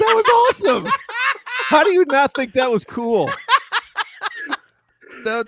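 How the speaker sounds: high, laughing delight; laughing praise. Real, not acted. Co-host delighted by the line, laughing high. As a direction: gush through laughter.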